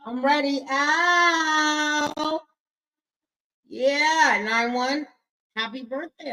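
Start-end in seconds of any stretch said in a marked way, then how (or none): 0.7-2.4 high "Ow" cry; high hype cry "Ow". Shown as a demonstration. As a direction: yelp "Ow" up high. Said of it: "but say, "Ow".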